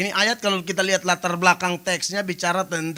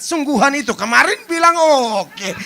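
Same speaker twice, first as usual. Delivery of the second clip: louder surprised quote; mild mock surprise; mock startled; 4 semitones higher, 6 dB louder than usual. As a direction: act the shock lightly. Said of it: "baru dia kaget".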